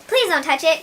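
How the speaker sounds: begging to save her house; distressed plea. A narrator's reading. Whitey pleading with the fox, distressed. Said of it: "called Whitey in great distress".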